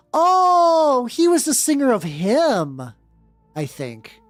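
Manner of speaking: normal talking voice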